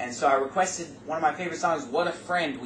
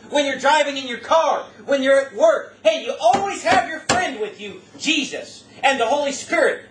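higher and louder, building fervent preaching; full-force preaching; 6 semitones higher, 6 dB louder than usual. Acted. Preacher rousing the congregation about their constant friend, fervent loud preaching. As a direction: preach it out to the back pews.

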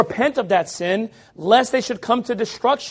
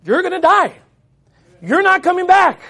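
louder and higher, a hard warning; blunt warning; 7 semitones higher, 5 dB louder than usual. Retold or imitated. Prophet Micaiah warning Ahab, loud and blunt. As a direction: hit the king with the bad news. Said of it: "Whereas Micaiah's like".

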